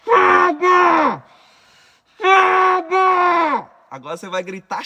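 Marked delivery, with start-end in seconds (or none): 0.0-3.8 full-throated screams, much higher and louder; screaming. Shown as a demonstration. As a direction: scream it out with everything you have. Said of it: "coloca o pano e grita".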